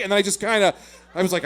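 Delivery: usual speaking voice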